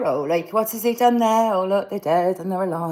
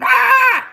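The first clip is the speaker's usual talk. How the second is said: sudden high, loud shriek; mock scream; 9 dB louder than usual. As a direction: let out one sharp, high scream. Sudden outburst mid-story.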